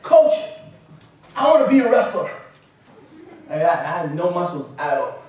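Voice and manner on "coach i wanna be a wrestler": loud, high announcement; eager kid shout. Retold or imitated. Eight-year-old boy declaring himself to his wrestling coach, eager shout. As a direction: call out boldly like a determined child.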